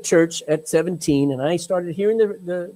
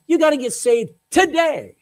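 urgent summons; 7 semitones higher, 4 dB louder than usual. Retold or imitated. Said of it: "And God was saying".

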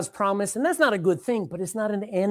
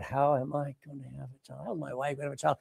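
inner thought; 7 semitones lower, 12 dB quieter, faster than usual. Acted. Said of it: "he's thinking in his heart".

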